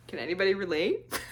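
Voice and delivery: asking through a laugh; giggling question to viewers. Real, not acted. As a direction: laugh while you ask.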